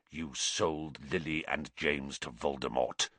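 low, hushed accusation; shaking accuser. A narrator's reading. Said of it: "who was shaking too".

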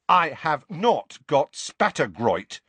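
slow, emphatic denial; indignant boy. A narrator's reading. Boy indignantly denying a disease to a portrait. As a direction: deny it word by word.

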